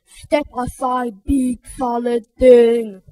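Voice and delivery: lumbering brute pushing someone aside; bully bellowing. Acted. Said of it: "Rudy bellowed at Fragility".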